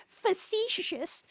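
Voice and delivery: slow, drawn-out Facetious; proud big word. Acted. Maggie shows off a big word to the British, slow and proud. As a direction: savour Facetious, stretching it slowly. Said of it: "I got a big word".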